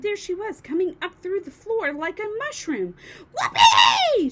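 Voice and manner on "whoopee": very high, loud whoop of joy; gleeful shout. A narrator's reading. Grandma to George, rising through the floor, whooping. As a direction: crow it out like a wild old woman. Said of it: "she shouted".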